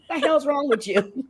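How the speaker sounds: high, laughing outburst at a nosy priest; mock indignation. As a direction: challenge the priest in playful disbelief, pitch up.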